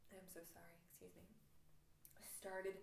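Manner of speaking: usual speaking voice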